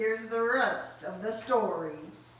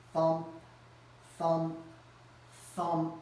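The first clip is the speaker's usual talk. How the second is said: low thumps; 4 semitones lower than usual. Acted. Banging sound.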